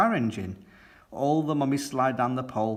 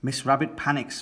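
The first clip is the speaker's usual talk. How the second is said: ordinary delivery, nothing marked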